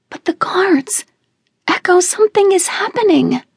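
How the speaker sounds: put-on high, urgent child's alarm; frightened little cousin calling out; young girl alarmed by the guards. A narrator's reading. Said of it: "Izzy's frightened eyes".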